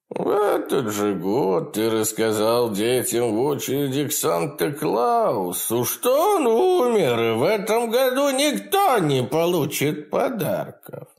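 high, gleeful imp voice; cackling hell torturer. Acted. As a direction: taunt him with cruel glee. Demon taunts.

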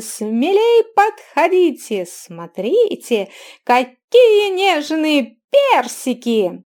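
piercing, slow, loud hawker's cry; loud carnival barker. A narrator's reading. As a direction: call out to the whole bazaar crowd. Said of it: "пронзительный мальчишеский голос".

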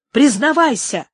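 sharp loud order; loud demand. Acted. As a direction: bark out the command. Interrogating the old man. (громкий приказ)

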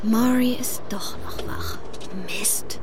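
hushed whisper, sneaking home. Acted. Beckett whispers on spotting Maury still awake. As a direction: whisper so nobody hears.

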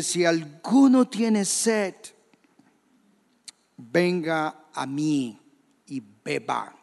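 slow, measured quote, falling away at the end; grito relatado. Retold or imitated. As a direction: space out each phrase without really shouting. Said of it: "Jesús les grita". (cita lenta y pausada, sin gritar)

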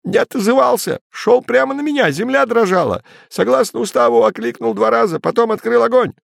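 high panicked report; desperate. A narrator's reading. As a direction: rattle it off desperately. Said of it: "с отчаянием в голосе выпалил Терман".

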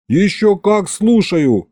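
insist, offended, dragging it out; indignant. A narrator's reading. Husband protesting to wife he does listen, slow and indignant. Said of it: "возмутился я".

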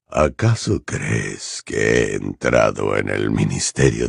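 slow, very low, cold threat; deep, drawn-out contempt; Voldemort, cruel. A narrator's reading. Voldemort dismissing Bellatrix's excuses, cruel and cold. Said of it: "Voldemort con crueldad".

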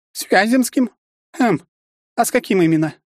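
very high, probing woman's voice; aristocratic mother. A narrator's reading. Mother probing whom her son met, high-pitched haughty questioning. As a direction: question him in a high ladylike voice.

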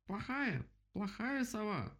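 very high, drawn-out scolding squeal; vole crying out. A narrator's reading. Vole scolds the prisoner, crying out high. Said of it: "крикнула полевка".

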